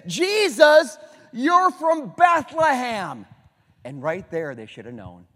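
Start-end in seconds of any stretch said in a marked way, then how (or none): 0.1-3.3 loud high shout, then slow on Bethlehem; scoffing crowd shout. Acted. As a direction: call out at him in disbelief.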